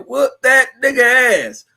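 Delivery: high, slow mock yell; hammed-up high fight call. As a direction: drag it out like a ringside heckler. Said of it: "Charleston stupid as fuck said".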